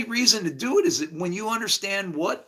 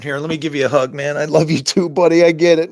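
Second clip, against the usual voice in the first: much louder, pretend buddy comforting; mock consoling hug; 11 dB louder, faster than usual. Pretending to hug a buddy who gets it, loudly consoling. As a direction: play a big consoling hug at full volume.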